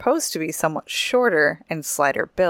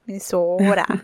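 mock Minnesota accent. Host echoing co-host's Minnesota joke, mock Minnesota accent. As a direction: say Minnesota like a local caricature. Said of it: "Or that's Minnesota".

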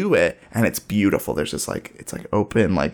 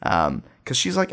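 ordinary talk, nothing marked

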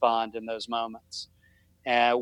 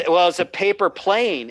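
ordinary delivery

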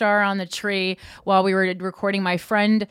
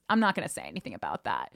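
muttered aside; 12 semitones lower, 8 dB quieter, faster than usual. Retold or imitated. Thought quoted, muttered to oneself.

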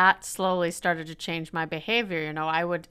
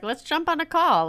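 eager invitation; 9 semitones higher, 3 dB louder than usual. Retold or imitated. Eagerly offering a call to people.

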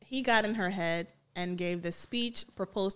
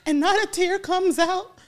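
loud, laughing complaint; 9 semitones higher, 8 dB louder than usual. Real, not acted. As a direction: laugh while ranting. Mocking fake crying voice.

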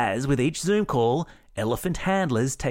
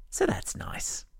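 whispered aside; 6 semitones lower, 5 dB quieter than usual. Real, not acted. Dry whispered aside after cute mascot.

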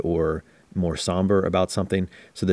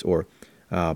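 normal talking voice

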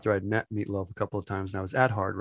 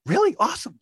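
excited reaction; 11 semitones higher, 3 dB louder, faster than usual. Retold or imitated. Hearing that [name] is here.

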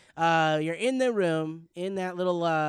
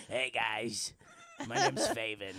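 chain smoker voice; 5 semitones lower, 4 dB quieter than usual. Retold or imitated. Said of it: "it sounds like a chain smoker".

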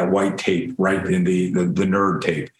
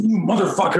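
higher-pitched imitation of angry cursing; retold angry outburst; 7 semitones higher than usual. Fallen classmate accusing the laugher, angry. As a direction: play the angry kid getting up.

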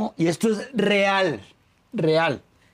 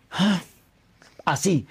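ordinary talk

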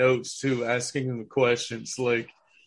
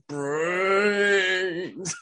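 long, high, drawn-out zombie cry; imitated zombie wail; 5 semitones higher than usual. Fan imitating how the zombie first says it, mock wail. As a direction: wail it like a hungry movie zombie. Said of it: "I like the way he first says it".

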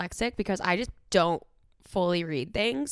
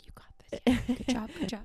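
hushed, higher cheering-on; whispered encouragement; 5 semitones higher than usual. Retold or imitated. Friend listening in on a parent call, whispered encouragement. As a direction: whisper support from the sidelines.

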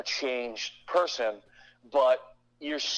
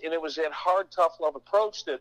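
ordinary talk, nothing marked

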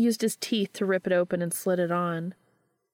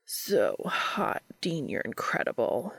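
low, groaning praise; groaned; 7 semitones lower, slower than usual. A narrator's reading. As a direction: groan it out, pitched well down. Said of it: "Cass groaned".

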